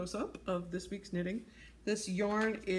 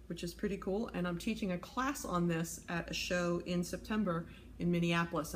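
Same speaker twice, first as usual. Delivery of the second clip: ordinary talk, nothing marked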